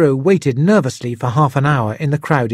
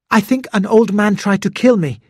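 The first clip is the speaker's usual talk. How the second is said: put-on higher, anxious young man's voice; lightly voiced young Hiro; 8 semitones higher, 3 dB louder than usual. A narrator's reading. Hiro to police officer, a touch higher and anxious. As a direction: blurt it out a little higher. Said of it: "he said".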